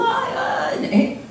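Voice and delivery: a high, strained "ay" in a sudden imitated cry; hammed-up weepy wail, mocking the overly emotional; a burst of imitated crying. Mocking tearful Pentecostal worshippers.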